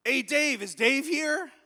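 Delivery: loud, high holler for a friend; calling out. Retold or imitated. Stranger in the bathroom looking for Dave, calling out.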